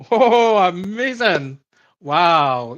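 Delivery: drawn-out, high, loud awe; mock amazement. Co-host reacting to an imagined magic trick, mock amazement. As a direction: gush in pretend wonder.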